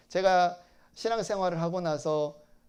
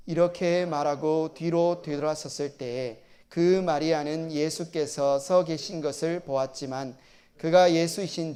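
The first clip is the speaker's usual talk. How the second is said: steady, measured Bible reading to the congregation; scripture reading; 5 dB louder than usual. Acted. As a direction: read the Gospel aloud with solemn care. Reads Gospel passage aloud before sermon.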